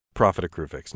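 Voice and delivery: ordinary talk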